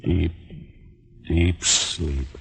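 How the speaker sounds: soft hushed trance voice; hushed hypnotist. Acted.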